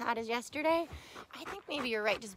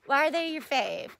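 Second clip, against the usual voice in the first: normal talking voice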